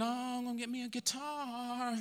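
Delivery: soft mock aside to himself; inner thought. Pastor retelling his own secret wish, murmured softly. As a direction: hush it like a private greedy thought. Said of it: "to myself, though".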